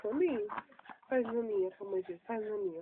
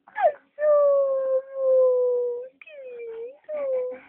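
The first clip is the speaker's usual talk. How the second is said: excited peekaboo; 10 semitones higher, 8 dB louder, much slower than usual. Acted. Peekaboo reveal to baby.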